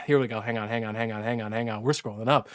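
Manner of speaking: low, soft repeated muttering; hushed, low holding remark; muttered aside. Real, not acted. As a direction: mumble it to yourself while searching. Mutters while scrolling back.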